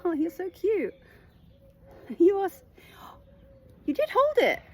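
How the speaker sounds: very high, loud cutesy coo; delighted cooing. Real, not acted. Reader to a cute little one, delighted cooing.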